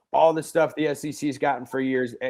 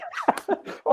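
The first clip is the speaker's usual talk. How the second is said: laugh. Real, not acted. Teasing Roman about LSU.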